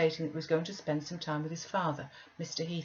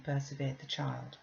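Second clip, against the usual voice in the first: ordinary delivery, nothing marked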